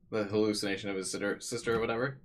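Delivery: normal talking voice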